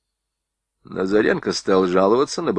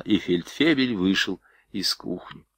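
usual speaking voice

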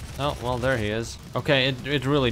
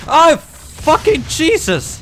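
startled scream; 12 dB louder, much slower than usual. Real, not acted.

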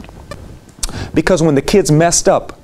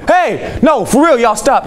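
sharp call, pitched high; mad student shout; 8 semitones higher, slower than usual. Retold or imitated. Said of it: "Then he got mad".

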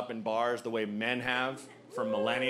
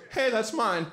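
pathetic protest; 6 semitones higher than usual. Retold or imitated. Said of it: "all that came out of me was".

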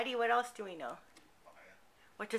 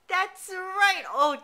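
higher, louder burst of praise; excited praise; 8 semitones higher, 6 dB louder, slower than usual. Real, not acted. Parent cheering toddler for pointing to ears, excited.

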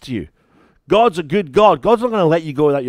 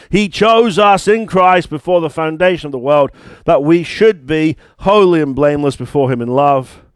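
louder, higher declaring voice; full-force preaching; 4 semitones higher, 5 dB louder than usual. Acted. Pastor proclaims blessings to church, louder and higher. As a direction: proclaim it to the congregation.

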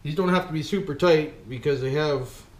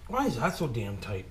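ordinary talk, nothing marked

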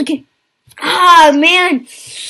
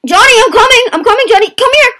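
high, excited chasing shout; calling out; 8 semitones higher, 4 dB louder, faster than usual. Real, not acted. Player chasing his friend Johnny in game, excited calling out. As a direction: holler high as you close in.

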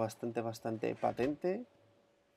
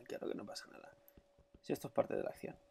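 whispered ironic aside; 8 dB quieter, faster than usual. Real, not acted. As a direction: whisper it to yourself sarcastically.

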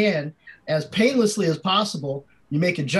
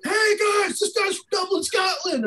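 much higher, calling to the guys; excited frat bro. Retold or imitated. Frat guy calling friends over, excited bro voice. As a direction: hype announcing him to the house.

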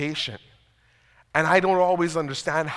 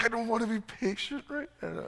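higher, whiny, sulky protest; childish protest; 5 semitones higher, 9 dB quieter than usual. Retold or imitated. Husband sulking back at his wife, higher whiny tone. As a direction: sulk like a kid who won't wait. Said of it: "And I'm like".

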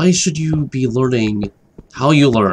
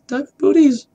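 much higher, delighted; excited squeal. Real, not acted. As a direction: squeal over the loot. Gloating over loot.

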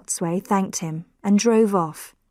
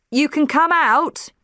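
calling out; 7 semitones higher than usual. A narrator's reading. Man calls to Mara that the snake is dead, calling out. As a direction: raise the voice to reach her in the van. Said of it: "he called".

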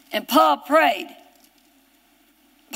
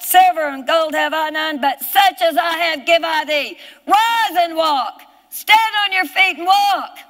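higher, ringing scripture proclamation; full-force preaching; 5 semitones higher, 4 dB louder than usual. Shown as a demonstration. Preacher voicing Peter healing the lame man, commanding shout. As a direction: command it with total faith, building to a shout. Same verse preached as believer.

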